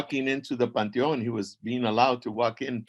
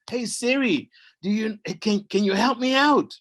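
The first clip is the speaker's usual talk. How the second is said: high, loud pleading call to a phone assistant; high, loud plea to Siri; 11 semitones higher, 5 dB louder than usual. Acted. Hero calls Siri for help.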